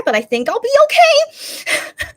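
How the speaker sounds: very high, loud, drawn-out false confidence; sing-song hammed-up hopefulness, stretching the end; mock optimism. Mock optimism before the sad song.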